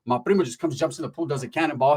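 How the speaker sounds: normal talking voice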